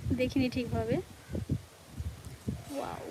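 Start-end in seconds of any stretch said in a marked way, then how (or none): 2.8-3.0 breathy gasp at a cold gust; soft, breathy wow in the wind; gasping in icy wind. Real, not acted. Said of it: "কি ঠান্ডা হাওয়া".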